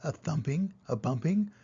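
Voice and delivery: usual speaking voice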